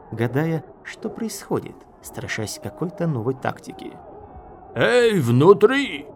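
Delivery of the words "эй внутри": long, loud, high call; hailing shout. A narrator's reading. Enemy officer calling to those inside, shouting. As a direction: holler slowly to those behind the door. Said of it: "голос с сильным канадским акцентом".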